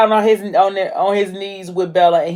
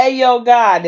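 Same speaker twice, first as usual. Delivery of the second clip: a louder, firmer retake; retold louder; 5 semitones higher, 5 dB louder, slower than usual. [name] opening his prayer to God, louder retake. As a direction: say it again, louder and straight.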